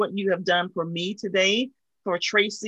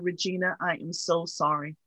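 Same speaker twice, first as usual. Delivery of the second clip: softer, hushed heartfelt apology; quietly sincere, full of sorrow; gentle sympathy; 3 semitones lower, 6 dB quieter than usual. Retold or imitated. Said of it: "And she simply said".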